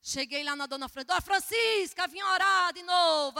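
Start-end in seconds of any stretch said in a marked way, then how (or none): 1.0-3.4 high, loud, drawn-out hail; cheerful calling out. Retold or imitated. Missionary arriving to pray, calling out to the owner. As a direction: call out to her, stretching it high and loud.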